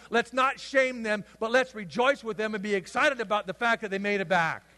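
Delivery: normal talking voice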